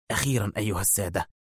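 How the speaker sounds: usual speaking voice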